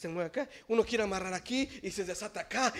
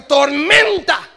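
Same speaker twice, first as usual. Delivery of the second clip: slow drawn shout; 9 semitones higher, 19 dB louder, much slower than usual. Acted.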